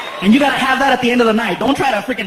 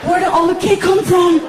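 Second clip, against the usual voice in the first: put-on much higher, loud child voice; little boy; 9 semitones higher, 5 dB louder than usual. Son Frankie walking into the kitchen, high kid voice. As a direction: play a sleepy excited kid.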